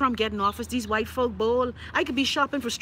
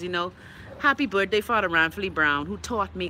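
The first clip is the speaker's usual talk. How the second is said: ordinary talk, nothing marked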